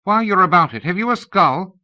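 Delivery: high, loud female voice; lady's voice. A narrator's reading. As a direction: ask it as a lady. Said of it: "came a lady's voice".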